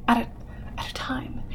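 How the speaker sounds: hushed repeat of "at a time"; whispering. Real, not acted. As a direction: drop to a whisper.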